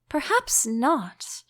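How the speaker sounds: slow, careful and hesitant; a hint of caution, slowed right down; cautious. A narrator's reading. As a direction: answer warily, drawing it out. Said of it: "Alice cautiously replied".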